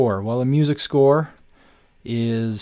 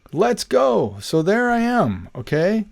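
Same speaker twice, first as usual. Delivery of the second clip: excited; 9 semitones higher, 4 dB louder, slower than usual. Real, not acted. Account finally created.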